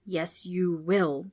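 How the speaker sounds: slow menacing. Acted. Ghost in her face.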